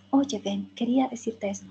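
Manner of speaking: a soft echo of the line at the same rhythm; shadowing softly. Shown as a demonstration. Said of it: "a ese ritmo".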